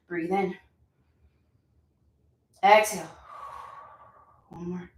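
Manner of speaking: usual speaking voice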